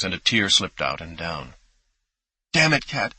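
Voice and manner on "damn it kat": sharp, higher cry of frustration; anguished outburst. Acted. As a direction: let it burst out through tears.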